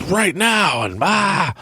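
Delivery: hammed-up high yelp of panic; mock panic. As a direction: voice shooting up into an alarmed cry. Mind imagining robbers in the house.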